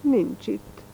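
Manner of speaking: put-on a boy detective about to cry; almost crying. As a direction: let it fall, close to tears. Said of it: "csaknem sírva mondta".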